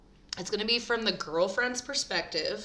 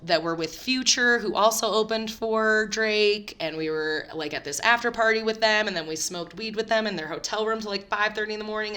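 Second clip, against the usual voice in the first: ordinary talk, nothing marked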